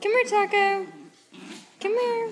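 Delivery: loud, high sing-song call; high call to the dog. Real, not acted. Owner calling Taco to come, high loud call. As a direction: call him over way up high.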